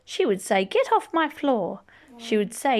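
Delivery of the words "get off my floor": a hint of a cross mother; lightly voiced stern mom; mock scolding mother. Mother scolding the child about duck feet, mild mock scold.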